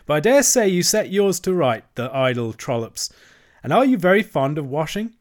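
brisk, hearty countrywoman voice; barge woman. A narrator's reading. Barge woman offering the washerwoman a lift, hearty. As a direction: sound helpful and sure of the way.

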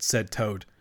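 plain reading voice

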